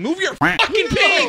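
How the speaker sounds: high, loud, drawn-out yell; exploding in frustration. Retold or imitated. Player to teammate blocking view, furious retold yell. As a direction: snap into a full mock scream.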